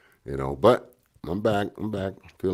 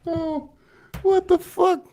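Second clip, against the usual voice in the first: much higher, disbelieving cry; shocked laughing disbelief; much slower than usual. Real, not acted. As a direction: react in stunned disbelief. Reacting to punchline.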